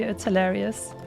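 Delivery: normal talking voice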